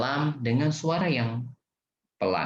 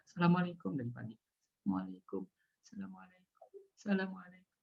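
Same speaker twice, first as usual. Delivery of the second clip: fading, much softer repeated salam; mumbled quiet greetings; 6 semitones higher, 10 dB quieter, much faster than usual. Shown as a demonstration. Teacher mimics someone greeting softly all day unheard. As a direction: murmur it over and over. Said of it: "orangnya nggak akan dengar".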